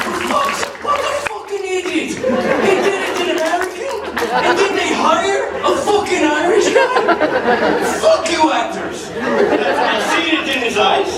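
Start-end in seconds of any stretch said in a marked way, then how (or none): 0.1-9.1 high, indignant mock Irish heckler, laughing through it. Irish guy mocking the fake dialect, high, indignant Irish heckle. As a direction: play an outraged Irish viewer heckling the actors, pitched way up. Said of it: "I seen one guy back there going".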